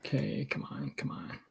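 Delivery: very soft, under the breath; real hushed self-talk while rolling; quiet urging. Real, not acted. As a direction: coax the dice along, barely audible.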